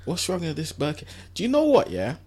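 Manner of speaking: usual speaking voice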